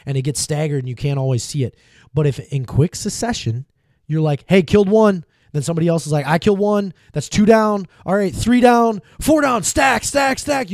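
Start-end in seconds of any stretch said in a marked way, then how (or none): 4.5-10.7 higher, louder, rising hype counting kills; excited pro callouts. Retold or imitated. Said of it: "You'll hear pros talk like this".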